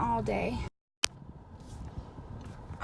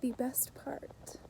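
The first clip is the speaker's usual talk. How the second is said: whisper; 4 semitones lower, 9 dB quieter than usual. Real, not acted. Relieved at home.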